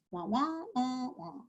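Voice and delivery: soft, droning wah-wah teacher noise; quiet cartoon trombone-like babble; Charlie Brown's teacher. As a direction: imitate an unintelligible teacher's muffled drone. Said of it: "sound like Charlie Brown's teacher".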